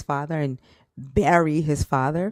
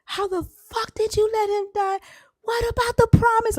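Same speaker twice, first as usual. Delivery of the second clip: high, loud anguished scream at the sky; screaming up at the sky over her father's death; screaming at God; 4 dB louder than usual. Retold or imitated. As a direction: rage and grief hurled upward. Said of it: "Like, I'm screaming".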